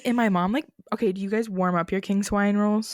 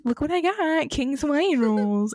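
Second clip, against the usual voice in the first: high, slow proud mom showing off rolls; imitating mom's delighted announcement; 7 semitones higher than usual. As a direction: mom presenting her prize, pitched up. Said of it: "My mom literally on Thanksgiving is like".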